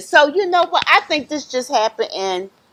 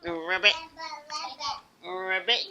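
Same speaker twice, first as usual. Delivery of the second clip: frog croak; 5 semitones higher, 6 dB quieter than usual. Pretend. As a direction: imitate a little frog for children. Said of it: "What does a frog do?".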